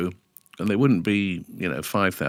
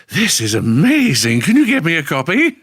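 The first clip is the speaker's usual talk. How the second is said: Kenny Everett; 9 semitones higher, 8 dB louder than usual. Retold or imitated. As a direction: impersonate an over-the-top enthusiast. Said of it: "he went, he goes".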